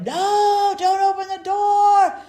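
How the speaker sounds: high, loud, drawn-out frightened scream; a terrified child begging; little girl screaming at grandmother not to open it. Retold or imitated. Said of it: "I'm starting to, like, scream".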